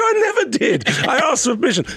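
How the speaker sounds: very high mock protest; indignant denial. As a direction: squeal a comic denial in a retold quarrel. Teller acts his protest in quarrel.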